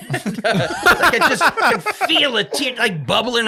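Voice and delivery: high laughing slow words, laughter breaking through. Real, not acted. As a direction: laugh your way through the line. Laughing while telling embarrassment.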